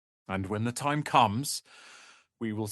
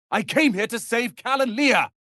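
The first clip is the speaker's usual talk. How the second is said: angry outburst; 11 semitones higher, 4 dB louder than usual. Acted. Heated argument.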